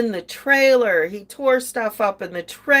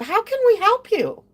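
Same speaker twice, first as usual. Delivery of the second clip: high, sing-song fake sweetness; mocking cop voice; 13 semitones higher than usual. Mocking the officer who showed up late, high sing-song sweetness. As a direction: ham up a chirpy, clueless officer.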